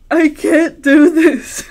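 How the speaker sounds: loud, slow, laughing despair. Retold or imitated. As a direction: blurt it out through laughter. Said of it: "And I was just like".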